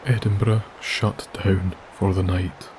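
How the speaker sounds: ordinary delivery, nothing marked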